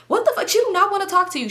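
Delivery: hammed-up angry friend; mock outrage. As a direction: play the friend snapping at him. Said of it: "So she was like".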